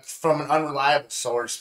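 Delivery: normal talking voice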